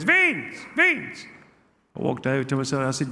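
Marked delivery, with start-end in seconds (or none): 0.0-1.3 put-on high, urgent Italian-accented call; Gino. Uncle calling his nephew from the hospital bed, high Italian-accented call. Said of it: "he saw me, goes".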